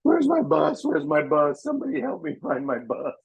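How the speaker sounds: high, pleading cry for the bus; mock lost bus driver. Bloodied prank driver begging strangers, high mock cry. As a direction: play the lost driver calling out. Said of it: "he was going".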